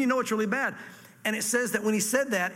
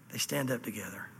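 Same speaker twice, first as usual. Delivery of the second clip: low, near-whispered reading; hushed; 9 semitones lower, 8 dB quieter than usual. Acted. Preacher reading of the heavens obeying God, hushed. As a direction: drop suddenly to a low hush. Dropped voice before mock summons.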